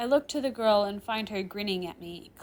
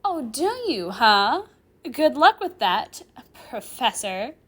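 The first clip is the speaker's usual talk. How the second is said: high, sarcastic swooping taunt; mock the title sarcastically, loud and drawn out; 4 semitones higher, 10 dB louder than usual. Girl disrespecting her professor's title, taunting. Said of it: "Rimuru replies tauntingly".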